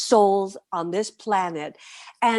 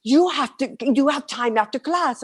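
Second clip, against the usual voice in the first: excited friend; 7 semitones higher than usual. Retold or imitated. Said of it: "And he's like".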